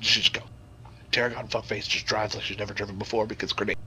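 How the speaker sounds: ordinary talk, nothing marked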